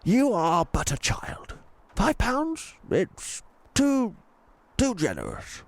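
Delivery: posh tramp, gently refusing; posh old tramp. A narrator's reading. Tramp refusing the girl's money, posh and kind. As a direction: turn down the child's gift kindly, posh.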